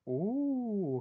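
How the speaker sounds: a high, intrigued "ooh". Acted. Woman eyeing the ring, an intrigued high "ooh". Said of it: "kind of expression on her face".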